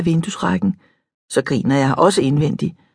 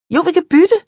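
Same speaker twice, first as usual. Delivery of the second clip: much higher, louder insisting; eager insistence; 11 semitones higher, 5 dB louder than usual. A narrator's reading. Mariam insists they swap hair, excited. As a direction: insist gleefully.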